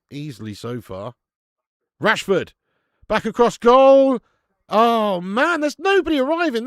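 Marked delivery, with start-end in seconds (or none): 2.0-4.2 loud, high shout of anticipation; urgent, drawn-out football yell; excited match call. Real, not acted. Calling a live attack. 4.7-6.6 high, loud wail of frustration; real frustrated fan shout; exasperated outcry. Real, not acted. Chance wasted, frustrated watcher.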